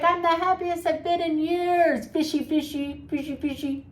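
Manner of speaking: high, sing-song bragging voice; smug show-off. Retold or imitated. Narcissist gloating to fans about scuba trips, high sing-song. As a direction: gloat about the fish pictures. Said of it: "And they're like".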